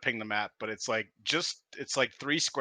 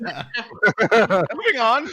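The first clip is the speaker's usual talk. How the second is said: laughing; 13 semitones higher, 17 dB louder, much slower than usual. Real, not acted. Laughing after a crude joke.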